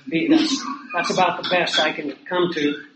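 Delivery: usual speaking voice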